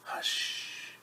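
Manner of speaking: breathy shushing whisper; hushed whisper. Acted. Storyteller whispers hush to listeners. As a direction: hush the room so baby sleeps.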